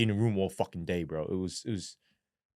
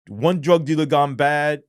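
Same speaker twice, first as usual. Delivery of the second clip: ordinary talk, nothing marked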